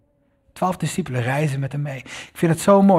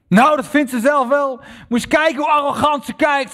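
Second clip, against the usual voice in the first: put-on much higher, louder scornful old lady; elderly woman scoffing at her reflection; indignant old woman; 12 semitones higher, 5 dB louder than usual. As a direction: sniff at the arrogant stranger. Old woman voiced in story.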